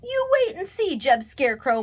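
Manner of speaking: high-pitched taunt; mocking. A narrator's reading. Crow mocking the young scarecrow, high jeer. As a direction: tease him, starting very high. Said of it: "mocked Bernie".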